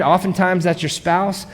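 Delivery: usual speaking voice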